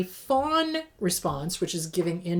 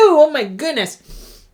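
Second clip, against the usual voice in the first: flustered after sneeze; 5 semitones higher, 8 dB louder, slower than usual. Real, not acted. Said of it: "I'm gonna sneeze".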